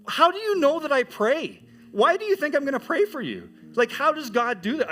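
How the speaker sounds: genuinely floored by the request; astonished. Retold or imitated.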